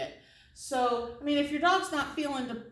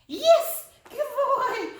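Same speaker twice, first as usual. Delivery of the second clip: excited praise; 13 semitones higher, 6 dB louder, much slower than usual. Real, not acted. Praising dog after out.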